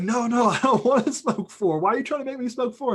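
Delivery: high, loud, panicky protest; guy pleading with furious Tony; frightened pleading. Retold or imitated. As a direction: beg him to stop, voice cracking high.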